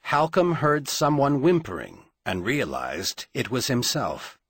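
plain reading voice

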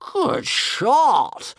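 high-pitched delighted roar; big gleeful creature bellow. A narrator's reading. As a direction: roar your approval with huge relish. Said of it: "roared One Eye, enjoying himself hugely".

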